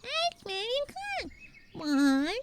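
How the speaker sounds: high, slow sing-song counting; mock game voice. Coach mocks the game line she won't say, high sing-song. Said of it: "sit here and go".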